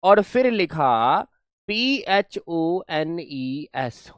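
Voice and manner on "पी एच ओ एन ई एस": letters spelt slowly one by one; spelling aloud slowly for students. Shown as a demonstration. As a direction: dictate P H O N E S slowly to the class. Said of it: "मैंने लिखा होमो".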